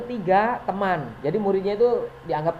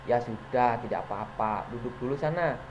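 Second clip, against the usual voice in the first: a soft, lower, easy-going voice with a smile; friendly teacher, smiling; 5 semitones lower, 6 dB quieter than usual. Shown as a demonstration. Friendly teacher letting a late pupil sit down, smiling and soft. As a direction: reassure the late pupil like a buddy. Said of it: "sambil senyum-senyum".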